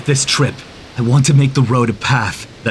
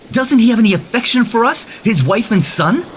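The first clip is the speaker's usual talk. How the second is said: higher, pleading hurt; hurt question; 7 semitones higher than usual. Acted. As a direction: ask it wounded, voice rising. Son upset about father.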